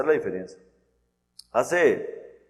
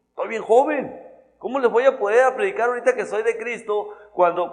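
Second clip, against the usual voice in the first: put-on worried young believer; 8 semitones higher, 4 dB louder than usual. Acting out a young believer's worry.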